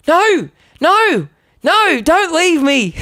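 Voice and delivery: high pleading whine, slowing down; panicked plea. Retold or imitated. The teen begging the band to stay, high whiny panic. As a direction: beg them not to go.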